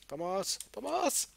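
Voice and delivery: hammed-up call for help; playful fake panic; mock cry for help.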